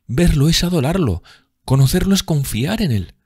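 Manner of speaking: put-on high, swooning young woman; adoring rapture. A narrator's reading. Sibyl rhapsodizes about her beloved. (adoración embelesada, con voz aguda)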